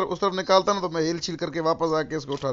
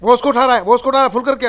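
loud, urgent team callout; urgent shout; 5 semitones higher, 7 dB louder, faster than usual. Real, not acted. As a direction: yell the warning at your teammate. Urgent callout during firefight.

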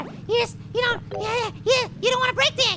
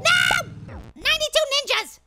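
high, exasperated outburst; comic curse; 4 semitones higher than usual. Real, not acted. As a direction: shout a silly swear at losing. Loses after high score.

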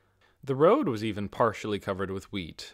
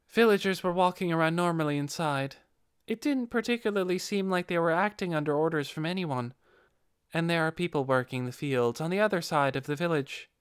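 lightly voiced Nabe; 7 semitones higher than usual.

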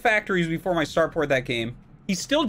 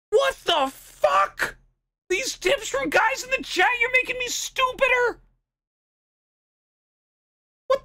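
furious shout; 12 semitones higher than usual. Real, not acted. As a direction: scream your disbelief at bad advice. Raging at chat tips.

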